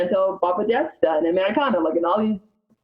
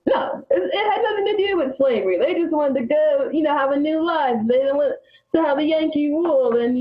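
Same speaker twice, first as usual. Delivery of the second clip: high mocking voice of defensive historians; 9 semitones higher than usual. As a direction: scoff at them in a high, sneering tone. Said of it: "they all try to say".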